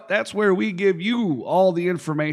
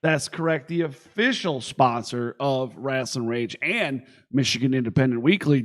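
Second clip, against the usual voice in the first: normal talking voice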